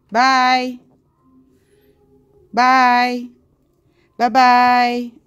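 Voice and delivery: loud, long-held goodbye call; teasing, loud singsong bye; mock goodbye. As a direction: call out a drawn-out pretend farewell. Pretending to leave, calling goodbye.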